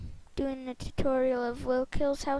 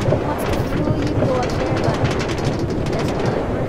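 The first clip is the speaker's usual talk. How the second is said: loud narration; 8 dB louder than usual. Real, not acted. Roller coaster drop.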